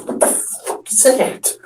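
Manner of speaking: high, slow breathless whisper of shock; startled whisper. Retold or imitated. Friend startled by the air horn, breathless. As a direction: gasp it out startled. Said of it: "I'm like".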